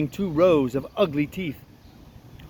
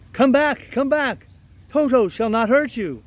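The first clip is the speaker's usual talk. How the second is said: raised, high-pitched call; 7 semitones higher, 3 dB louder than usual. Acted. Woodman calling back the scattered mice, high call. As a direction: call after someone running away. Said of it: "he called to the mice".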